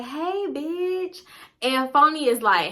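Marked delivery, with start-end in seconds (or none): 0.0-1.2 put-on high, drawn-out girly greeting; Chelsea. Chelsea greeting Phony from the car, high drawn-out greeting. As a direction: sing out a greeting, pitched way up. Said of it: "Chelsea get out the car and she like".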